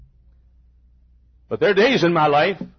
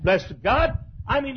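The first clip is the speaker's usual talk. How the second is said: ordinary talk, nothing marked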